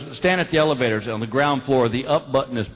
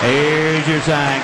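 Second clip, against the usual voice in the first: slow, weighted on your; slow catchphrase; 4 dB louder, much slower than usual. Acted. Comic closes the show, slow punchline. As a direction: finish on the tag line.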